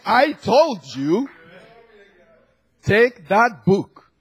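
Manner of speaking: loud, high, drawn-out reprimand; acting a stern, insistent order; emphatic rebuke. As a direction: spell it out slowly and forcefully. Acted rebuke from the Lord.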